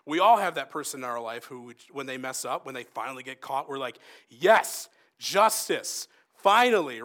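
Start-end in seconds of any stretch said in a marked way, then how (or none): 4.3-7.0 loud, higher, punched-out exclamations; mock gloating victory cry; triumphant cheering. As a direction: celebrate it like overdue payback. Acts out gloating at someone caught.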